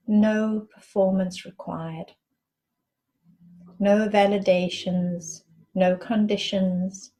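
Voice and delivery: measured meditation guide, long silences between phrases; guided meditation. Acted. Guide asking listeners to breathe and rest into the space, calm meditation voice. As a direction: lead them inward in unhurried phrases, letting each one settle.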